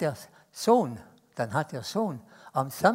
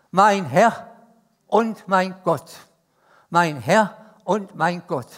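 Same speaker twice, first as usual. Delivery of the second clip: solemn proclamation; 8 dB louder, slower than usual. Acted. Thomas's confession, proclaimed slowly.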